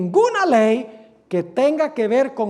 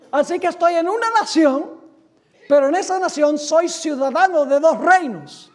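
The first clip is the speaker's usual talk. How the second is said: rising preaching; 5 semitones higher, 5 dB louder than usual. Real, not acted. Preacher's climax.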